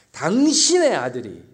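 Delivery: loud scornful stress on 당신의 아들이; contemptuous retort. Acted. Son disowning his brother to the father, loud and scornful. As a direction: throw the words back at the father loudly. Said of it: "뭐라고 얘기합니까?".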